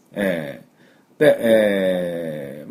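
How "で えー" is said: ordinary delivery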